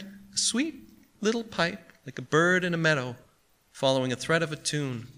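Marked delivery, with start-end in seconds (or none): none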